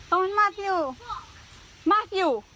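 loud high call of a name. Real, not acted. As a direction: call him from across the yard. Calling child by name.

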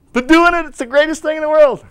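excited. Real, not acted. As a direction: burst out with joy. Preacher's excited climax.

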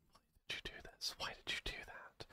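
hurt, breathless whisper. Real, not acted. Streamer whispering at the game that betrayed them.

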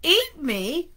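a storyteller's half shriek of disbelief; mild shriek. A narrator's reading. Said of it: "Arnie shrieked".

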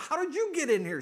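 high, loud challenge; acted shocked demand; incredulous ex-wife. Ex-wife confronts her ex in heaven, incredulous.

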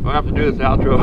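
talking with a mouthful of jerky; mouth full. Real, not acted. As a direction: chew and talk through the food. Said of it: "with my mouth full".

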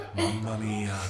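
ordinary talk, nothing marked

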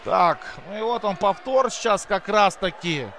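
usual speaking voice

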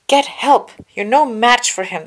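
high, loud urgent order; Chancellor ordering. A narrator's reading. Chancellor orders the two Jedi, high and loud. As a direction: bark the warning at the Jedi. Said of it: "the Chancellor ordered".

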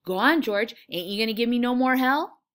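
put-on keen, childlike urging; eager. A narrator's reading. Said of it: "Lenny looked eagerly at him".

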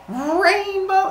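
very high, drawn-out squeal; mock childlike delight; awestruck cheer. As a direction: squeal with hammed-up wonder. Humans thrilled by rainbow.

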